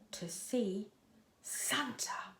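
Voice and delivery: hushed whisper, like a secret. A narrator's reading. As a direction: breathe the name like a secret. Secret reveal of the destination.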